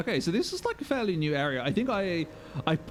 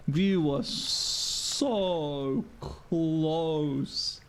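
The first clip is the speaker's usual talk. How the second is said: extremely slow, with SO and CLOSE stretched long; exaggerated drawn-out lament; much slower than usual. Real, not acted. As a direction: wail it out, dragging "so" and "close". Repeats the lament, more drawn out.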